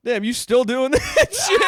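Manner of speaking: high, loud, slow delighted disbelief; high, loud amused old friend teasing; amused surprise. Retold or imitated. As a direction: tease him like an old friend. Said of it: "he goes".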